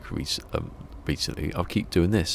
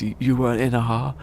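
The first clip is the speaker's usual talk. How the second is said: higher, disbelieving question; incredulous Greg; 7 semitones higher, 4 dB louder than usual. Retold or imitated. Greg Davies questioning the driver's claim, incredulous. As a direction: ask it in disbelief.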